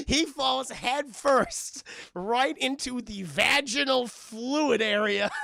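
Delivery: slow, drawn-out delivery; milk the gross punchline; slowed for effect. Real, not acted. Telling chat the gross part, slowed right down.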